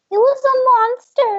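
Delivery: high little elf piping up; a small elf keen to tell; eager elf voice. Acted. Third elf tells of monster.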